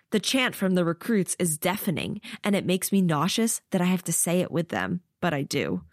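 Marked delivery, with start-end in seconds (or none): none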